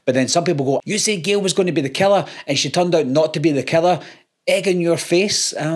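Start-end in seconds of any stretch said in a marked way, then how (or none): 0.8-5.5 higher-pitched, quick taunting voice; mock gloating. Commenters gloating over a wrong guess, mock taunting. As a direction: imitate a crowing know-it-all.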